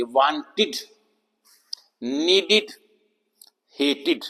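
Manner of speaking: वांटेड, नीडेड, हेटेड with endings said "-id"; short-i ending demo. Shown as a demonstration. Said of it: "आवाज छोटी ई की आएगी". (वांटेड, नीडेड, हेटेड के अंत में छोटी इ, "इड")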